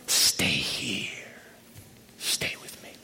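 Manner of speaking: soft, unvoiced whisper; whispered plea. Preacher echoing Jesus' request, intimate whisper. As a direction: draw the listener close and whisper.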